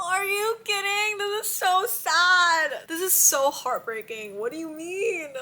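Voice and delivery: high, slow, wailing dismay; squeaky heartbroken protest; high whiny wail. Real, not acted. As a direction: whine it out, heartbroken by the book. Reacting to the sad scene.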